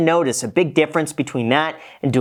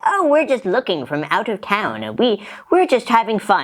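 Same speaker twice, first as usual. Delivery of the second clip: mock tourist voice; 6 semitones higher than usual. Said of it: "they're like".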